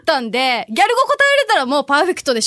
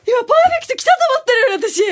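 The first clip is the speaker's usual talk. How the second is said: fast frustrated rant; 11 semitones higher, much faster than usual. Real, not acted. Lost the perfect score.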